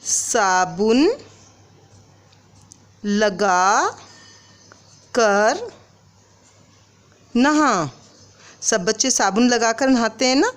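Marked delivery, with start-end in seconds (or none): 0.0-7.9 very slow with a rising end; stretched word ending up; slow dictation, rising end. Shown as a demonstration. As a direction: dictate slowly, leaving it open. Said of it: "कैसे लिखेंगे".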